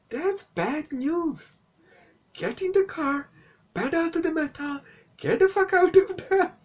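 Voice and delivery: very high, squeaky scolding; exasperated rant. Pretend. Reviewer telling dad to flee, high-pitched exasperation.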